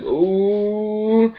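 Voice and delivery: high teasing crowd ooh; mock crowd ooh. Crowd reacting to the trash talk with a high ooh. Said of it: "all the crowd's like".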